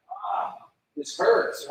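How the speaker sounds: breathy, strained groan of pain; sore groan. Retold or imitated. Someone getting out of bed sore, a breathy groan. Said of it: "and you just groan".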